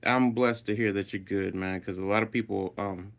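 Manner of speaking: ordinary talk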